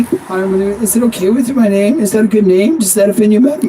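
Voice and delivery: mock a needy guy asking if his name is okay; hammed-up timid question voice; insecure uptalk. Shown as a demonstration. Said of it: "You wanna be very fucking careful not to do that".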